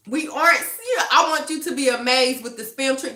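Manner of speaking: ordinary talk, nothing marked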